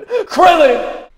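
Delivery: very high, very loud anime scream. Real, not acted. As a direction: scream the name with everything you've got. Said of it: "to just scream Krillin like that".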